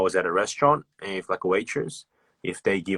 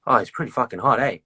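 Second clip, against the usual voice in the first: Aussie emphasis; 4 semitones higher, faster than usual. Retold or imitated. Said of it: "So it's like".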